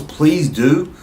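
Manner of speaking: ordinary talk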